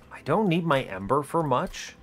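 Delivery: ordinary talk, nothing marked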